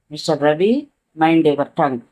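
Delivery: ordinary delivery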